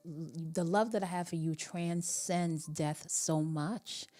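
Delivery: ordinary delivery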